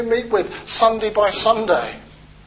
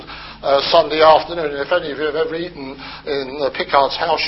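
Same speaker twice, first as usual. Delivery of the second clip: ordinary delivery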